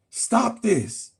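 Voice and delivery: ordinary talk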